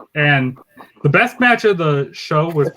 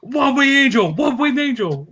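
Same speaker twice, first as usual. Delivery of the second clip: high, excited mock move call; parody wrestling announcer; 10 semitones higher than usual. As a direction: imitate a commentator frantically calling the finisher. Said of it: "That's the other Don".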